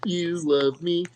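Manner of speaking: put-on slow, sweet children's dinosaur voice; Barney voice. Goofing around at the costume shop as a slow Barney. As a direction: play the purple dinosaur for laughs, slowly. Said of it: "So I just started going".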